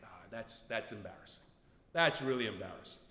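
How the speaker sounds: low, subdued mumble to himself; soft, deep, sheepish admission; embarrassed aside. Retold or imitated. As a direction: sink the voice in mock shame.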